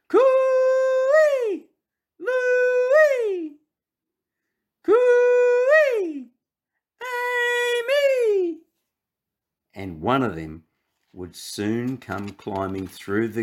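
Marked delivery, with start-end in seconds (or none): 0.1-8.6 high, long hollering call; calling out coo-ee. Acted. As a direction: call out far across the yard. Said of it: "they called to each other across the fence".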